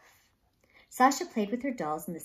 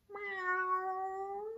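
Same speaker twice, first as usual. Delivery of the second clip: high, soft cat meow; a gentle, high meow; sweet meow; 13 semitones higher, 5 dB quieter than usual. Acted. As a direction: coax it out like a sweet kitten. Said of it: "the cat called sweetly".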